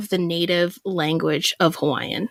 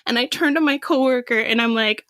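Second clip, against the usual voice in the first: ordinary talk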